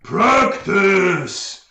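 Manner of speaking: loud, high-pitched ogre bellow; ogre roar. Acted. Game master as ogre charging at player, roaring. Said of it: "he comes rushing in and he says".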